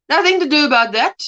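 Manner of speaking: usual speaking voice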